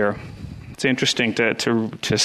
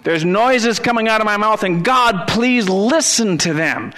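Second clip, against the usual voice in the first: David calling to God; 7 semitones higher than usual. Retold or imitated. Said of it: "David said".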